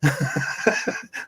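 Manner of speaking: a breathy chuckle at his own punchline; short airy laugh. Real, not acted. The narrator laughing at the end of his scorpion fable, soft chuckle. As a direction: let out a quick soft laugh.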